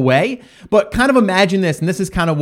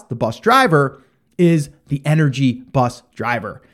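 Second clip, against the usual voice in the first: normal talking voice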